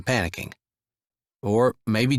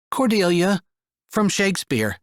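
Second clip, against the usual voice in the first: put-on higher, plain young girl's voice; a kid used to the question; older girl explaining her name; matter-of-fact girl; 9 semitones higher than usual. A narrator's reading. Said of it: "The voice was matter-of-fact".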